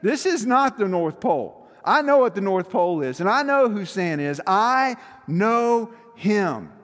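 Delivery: loud, insistent imitated arguing; Buddy the Elf. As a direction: argue with excited conviction, stretching the final phrase. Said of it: "he gets in an argument with him".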